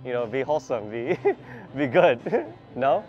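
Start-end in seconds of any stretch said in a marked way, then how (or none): none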